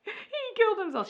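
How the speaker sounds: very high, loud mock gasp of horror; fake shock. Wife faking shock at husband's death, hammed-up horror. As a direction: ham up a staged discovery. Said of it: "and she's like".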